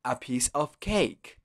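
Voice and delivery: "a piece of cake" slowed down, word by word. Shown as a demonstration. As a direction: repeat it slowly for the learner. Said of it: "Otra vez". ("a piece of cake" más despacio)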